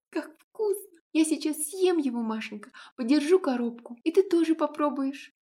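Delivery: put-on high little girl babbling through a mouthful; talking while chewing cake; child with mouth full. A narrator's reading. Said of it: "С полным ртом девочка пролепетала".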